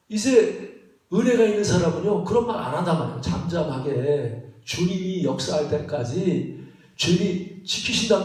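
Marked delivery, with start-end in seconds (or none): none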